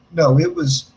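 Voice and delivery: plain reading voice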